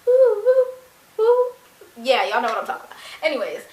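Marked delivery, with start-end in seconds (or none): 0.1-1.5 high loud sung woo imitating a whistle; whistle imitation. Mimicking her cousin's whistle to call the dogs, high and loud. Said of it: "y'all know the little note".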